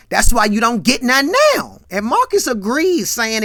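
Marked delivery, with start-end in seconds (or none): 0.1-1.8 loud, higher-pitched acted jab; loud retort. Friend teasing his buddy over women, loud retort. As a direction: throw the jab out loudly.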